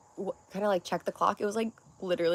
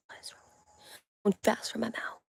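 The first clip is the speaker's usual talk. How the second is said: whisper; 3 semitones higher, 6 dB quieter than usual. Real, not acted. Muttering to herself mid-stream.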